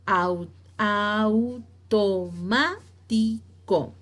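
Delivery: "automático" very slowly, syllable by syllable; split into syllables. Shown as a demonstration. Teacher giving an esdrújula example, "automático" in separate syllables. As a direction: pause between au, to, má, ti, co in "automático".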